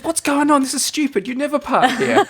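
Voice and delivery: high, exasperated mock voice; mimicking Kylie. Teller mimics his fiancée baffled by the parking, high and exasperated. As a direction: squeak it out in disbelief. Wife's quote in parking argument.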